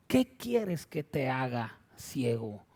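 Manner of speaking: slow, low, soft question; weighty, measured questioning; magnified quote of Jesús. Acted. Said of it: "lo estoy magnificando para que usted entienda".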